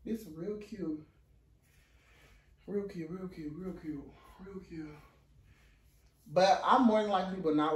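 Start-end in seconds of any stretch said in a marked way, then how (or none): 0.1-4.9 soft admiring murmur. Real, not acted.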